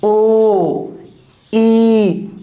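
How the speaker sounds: "ओ" and "ई" each held long and steady; isolated long vowels. Shown as a demonstration. Teacher isolating last sounds of words, "ओ" and "ई" held long. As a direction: sound out "ओ", pause, then "ई", both stretched. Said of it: "इनका last".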